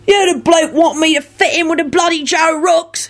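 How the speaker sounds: high-pitched, indignant rogue; aggrieved outburst in a character voice; angry. A narrator's reading. Mundungus answering Lupin's mockery, angry. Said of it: "he responded angrily".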